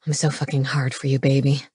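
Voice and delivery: low, quickened lustful murmur; seductive come-on. A narrator's reading. Aroused man to the woman, seductive low voice. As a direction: drop it low with desire.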